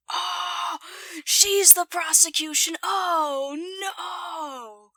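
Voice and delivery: loud, high cry of dismay; shocked dismay. Real, not acted. Viewer learning she is the prosecution, shocked dismay. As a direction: gasp it out high, then sink into a drawn-out no.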